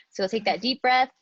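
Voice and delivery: ordinary talk, nothing marked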